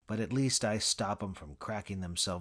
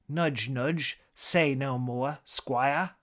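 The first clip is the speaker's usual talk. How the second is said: cheeky higher-pitched British catchphrase; nudge-wink English comic delivery, raised and loud; British accent; 5 semitones higher, 5 dB louder, much slower than usual. Acted. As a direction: quote it in a playful British accent. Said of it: "your British accent".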